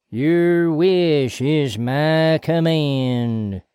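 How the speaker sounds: slow, eerie, possessed voice; possessed monotone. Acted. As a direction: drone it like a hypnotized man. Possessed Bobby Ray.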